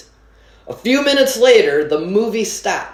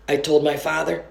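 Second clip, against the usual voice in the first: usual speaking voice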